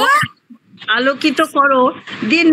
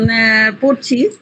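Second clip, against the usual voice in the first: normal talking voice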